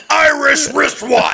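very high, slow shout; forced tongue twister. Shown as a demonstration. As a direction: force each word out at a yell.

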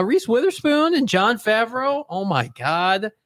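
incredulous. Real, not acted. Disbelief friend hasn't seen movie.

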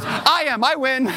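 put-on high, triumphant child's crow; imitated little daughter. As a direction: gloat like a small girl who just won. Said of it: "She goes".

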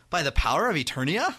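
"Eternia" rising sharply into a question; questioning battle cry. Retold or imitated. Host imitating Adam's confused line, questioning. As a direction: end the cry on a doubtful upswing on "Eternia". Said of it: "It's like it's a question".

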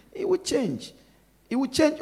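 reassuring. Acted. Reassuring the congregation.

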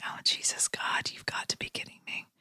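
breathy disbelieving whisper under the breath; exasperated whisper. Retold or imitated. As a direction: whisper it in exasperation, barely audible. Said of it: "I was like".

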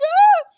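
high, loud scream; short shriek while scrambling to escape; comic high-pitched cry of alarm; reenacted panicked yelp. Reenacts trying to climb up.

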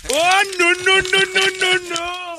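squealing high-pitched no's. Pretend. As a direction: panicky falsetto no's. Panicked mock reaction.

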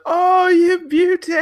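high, slow, drawn-out commentator cry; a delighted co-commentator savouring the moment; Andy Gray catchphrase. Retold or imitated. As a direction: belt it like an excited pundit. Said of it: "like, that, that kind of stuff".

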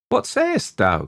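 high-pitched, startled question; maiden's high cry of disbelief. A narrator's reading. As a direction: cry out in surprise, voice leaping up. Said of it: "cried Iseult".

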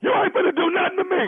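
high, fast comeback; mock defiant. Caller answering husband's threat of a whooping, mock defiant. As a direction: fire back at a threat, pitch way up.

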